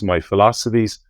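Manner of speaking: ordinary talk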